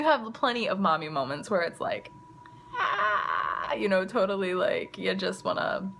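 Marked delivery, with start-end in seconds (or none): 2.7-3.7 high, pitched-up "aah" of overwhelm; mock frustrated scream. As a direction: let out a short high mock wail. Voices the feeling of mommy moments.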